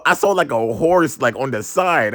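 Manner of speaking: ordinary delivery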